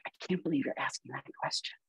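mock a classmate sniping quietly; quiet jab. Student mocking a classmate's question, quietly.

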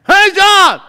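imitated neighbor yelling, very high and loud; comic bellowed greeting; Louie. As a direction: holler it at full volume for laughs. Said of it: "he talked like this".

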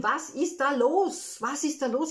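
lauter, höher, verzweifelt gerufen; nacherzählter Schrei. Retold or imitated. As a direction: ruf es ratlos hinaus, gedehnt beim ersten Mal. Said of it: "Ich hab dann geschrien". (verzweifelter Ruf, nacherzählt)

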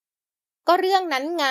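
a hint of an embarrassed pout; huffy. A narrator's reading. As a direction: grumble it out, cornered and annoyed. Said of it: "ท่าทางฮึดฮัดขัดใจ".

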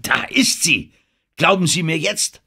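high, triumphant exclamation; near-hysterical excitement. A narrator's reading. Denham demands to be believed, almost hysterical with excitement. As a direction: burst out with it, pitch climbing. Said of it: "Er war fast hysterisch vor Aufregung".

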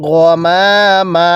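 long drawn-out chanted recitation, every vowel stretched; slow Quran recitation. Shown as a demonstration. Teacher models the verse for students, slow drawn chant. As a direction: intone it slowly, as the teacher's model reading. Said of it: "কিভাবে পড়ব?".

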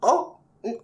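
high, slow robot voice; robotic katakana speech. Acted. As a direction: drone it like a computer. Question spoken as computer.

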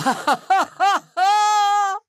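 high cackle held long at the end; evil cackle. Acted. Sea witch delighting in the storm, evil cackle. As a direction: cackle high, drawing out the last ha.

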